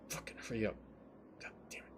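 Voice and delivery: soft, grumbling impatience; impatient mutter. Acted. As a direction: mutter it under your breath.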